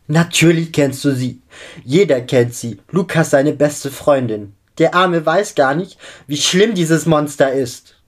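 desperate shouting. A narrator's reading. Girl telling vampire about the monster, desperate shout. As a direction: cry it out in despair, rising in pitch. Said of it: "schreit Josephine verzweifelt".

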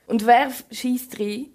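normal talking voice

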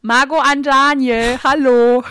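loud, slow pretend radio call. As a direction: call out over a crackly radio.

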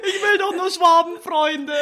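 high, drawn-out whine through laughter; teasing imitation of a needy friend; mock plaintive plea. Co-host voices her friend's plea.

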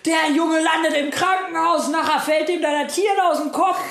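put-on retelling the boss screaming at the trainer over the boy, high-pitched rant; angry shouting. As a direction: yell at him for failing the boy. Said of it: "und brüllen sich voll an".

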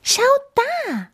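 very high little mouse squeak; squeaky mouse. A narrator's reading. Mouse pointing friends to a window, high squeak. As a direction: pipe up like a tiny mouse. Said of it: "piepste die Maus".

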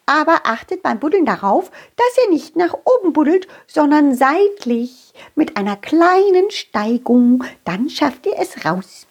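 high whispery mole; small creature giving advice; little mole voice. Acted. Mole explains digging.

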